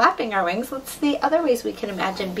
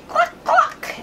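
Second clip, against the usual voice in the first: high, loud mock clucking; hen cluck; 6 dB louder than usual. Playing a hen for child.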